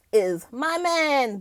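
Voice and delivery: ordinary talk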